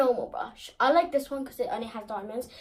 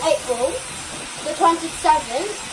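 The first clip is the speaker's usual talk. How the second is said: raised voice over the noise; over hair dryer; 5 semitones higher, 7 dB louder than usual. Real, not acted. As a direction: talk loudly over the dryer. Child answering over hair dryer.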